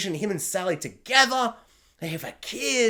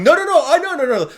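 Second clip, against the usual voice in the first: high, loud rush of objection; vehement mock protest; 10 semitones higher, 8 dB louder, faster than usual. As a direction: cut in with animated, protesting refusal. Acts out refusing to side with characters.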